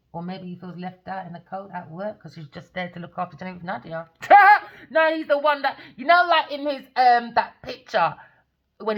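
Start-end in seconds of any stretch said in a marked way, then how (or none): 4.1-4.7 loud high laugh. Real, not acted. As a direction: burst out laughing. Burst of laughter.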